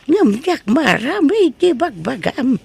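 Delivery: ordinary talk, nothing marked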